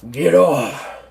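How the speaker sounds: loud growled command from a general; gruff order. Acted. General snaps at the doctor.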